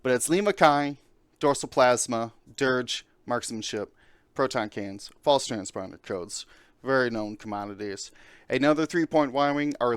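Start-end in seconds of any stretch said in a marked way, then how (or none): none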